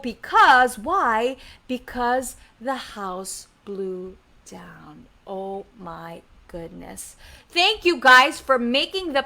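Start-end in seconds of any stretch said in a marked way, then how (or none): 1.7-7.1 slow, low, very soft storytelling; hushed dismay. A narrator's reading. Storyteller tells kids the house fell, hushed and slow. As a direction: let it sink almost to nothing.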